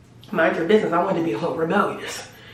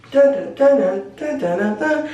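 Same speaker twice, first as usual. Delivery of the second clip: sung-out ringtone jingle, starting high; imitated ringtone; 6 semitones higher than usual. Mimicking her phone ringing on the bus, sung jingle. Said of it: "My ringtone back in the day".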